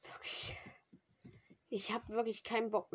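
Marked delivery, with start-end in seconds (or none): 0.0-0.6 whispering. Real, not acted. Frustrated after dying.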